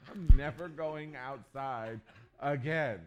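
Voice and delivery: loud and slow, laughing through it. Real, not acted. Pastor telling the congregation his fear, laughing. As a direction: crack up as you say it.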